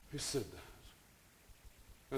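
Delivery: breathy startled whisper; hushed startled whisper. Retold or imitated. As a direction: whisper it, spooked and slow. Startled at unseen voice.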